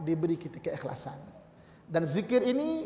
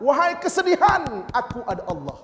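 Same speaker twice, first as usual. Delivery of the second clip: 1 bold declaration; 6 semitones higher, 7 dB louder than usual. Shown as a demonstration. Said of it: "Tapi katakanlah".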